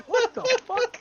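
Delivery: high, loud laughing outburst; real laughing disbelief. Real, not acted. Player reacting to an arm used as weapon, laughing disbelief. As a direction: blurt it out through helpless laughter. Group cracks up at absurd attack.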